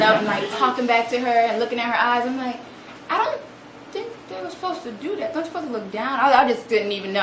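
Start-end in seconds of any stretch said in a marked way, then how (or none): 3.1-6.2 high-pitched, hesitant disbelief; shocked. Retold or imitated. Teacher watching students talk back to boss, shocked. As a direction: say it high, taken aback. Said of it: "I was just shocked".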